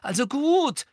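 high, tense resolve; anxious but determined. A narrator's reading. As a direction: push it out nervously but firmly. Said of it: "sagte sie ängstlich, aber entschlossen".

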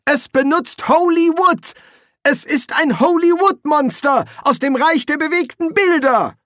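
very high, loud alarm cry; panicked high-pitched shouting; screaming. A narrator's reading. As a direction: shriek the warning at the top of the range. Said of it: "schrie Ginger".

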